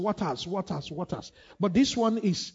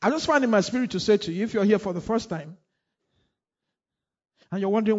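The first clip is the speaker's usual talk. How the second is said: usual speaking voice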